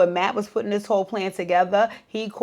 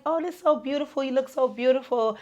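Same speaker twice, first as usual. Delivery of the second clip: gushing friends; 7 semitones higher than usual. Retold or imitated. Friends gushing over the gown.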